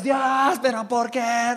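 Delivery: high, whining protest; mock tantrum cry. Believer protesting to God, mock tantrum. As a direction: cry out like a child throwing a fit. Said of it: "te enojes y patalees y grites".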